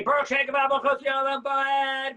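loud, high, slow chanted proclamation. Shown as a demonstration. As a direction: call it out proudly like an angel. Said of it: "We say it out loud".

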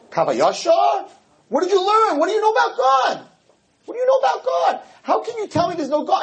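high, shouted repeated question; mock heated outburst; rising challenge. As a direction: hammer him with the question. Rabbi berates the atheist.

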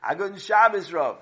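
high, loud sing-song crowd greeting; the whole shul calling back together; congregation greeting. Acted. Congregation answering the Rav's Shabbos greeting, loud and sing-song. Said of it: "everyone in the shul said".

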